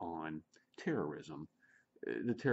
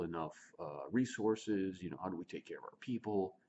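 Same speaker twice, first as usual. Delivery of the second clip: ordinary delivery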